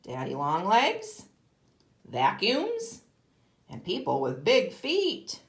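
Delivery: storybook reading to a class; reading a picture book. Acted. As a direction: read it like a picture book for kids. Teacher reads picture book aloud.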